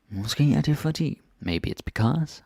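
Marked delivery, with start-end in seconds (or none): 0.1-1.2 Måske er det fordi lifted high in a changed voice; higher, marked repeat. Shown as a demonstration. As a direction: say it up high to highlight the inversion. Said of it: "And there's inversion".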